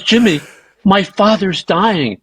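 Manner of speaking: slow, high mock reproach; shocked protest. Woman reminds him her father is dying, high shocked reproach. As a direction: imitate her appalled reproach, drawing out the words.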